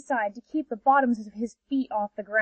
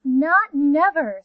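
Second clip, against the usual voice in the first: slow, loud and higher, stubborn; stubborn refusal; 4 semitones higher, 6 dB louder, slower than usual. Acted. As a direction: dig in their heels, word by word. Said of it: "said the rays".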